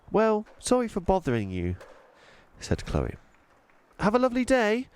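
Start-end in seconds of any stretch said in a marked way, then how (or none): 0.1-1.8 put-on high, curious little-girl voice; young girl Chloe. Chloe apologizes. 4.0-4.9 put-on high, curious little-girl voice; young girl Chloe. Chloe says goodbye.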